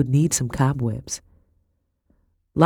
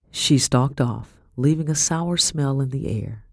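ordinary delivery, nothing marked

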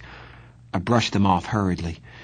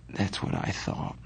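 sleepy, much softer whisper; drowsy; 8 dB quieter than usual. Acted. Ponyboy drifting off beside Johnny, drowsy whisper. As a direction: murmur it half asleep. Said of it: "I said drowsily".

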